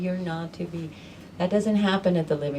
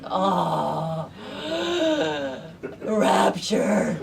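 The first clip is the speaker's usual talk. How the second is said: rapturous gasp; much slower than usual. Retold or imitated. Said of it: "It's like".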